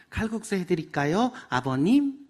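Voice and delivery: 아버님 with the ending lifted up; rising ending. Shown as a demonstration. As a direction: sweetly lift the end of 아버님. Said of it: "끝을 올려라". (끝을 올리는 애교 있는 며느리 말투)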